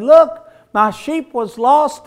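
high, loud, drawn-out shepherd's cry; joyful announcing. Acted. Shepherd bringing the lost sheep home, joyful announcing. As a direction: call it out slowly to everyone at home.

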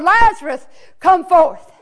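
loud commanding call; a ringing summons at the tomb; hollered call. Acted. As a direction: call him out of the tomb with authority. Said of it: "he hollered".

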